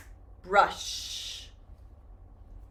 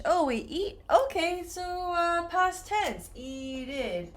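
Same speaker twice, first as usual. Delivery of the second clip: higher, puzzled student working it out; mock student; 8 semitones higher, slower than usual. Teacher imitating a student guessing a past tense. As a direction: play a confused learner thinking aloud. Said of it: "Many students think".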